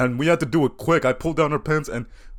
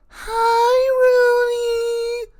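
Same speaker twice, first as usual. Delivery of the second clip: very high, sing-song flirty greeting; slower than usual.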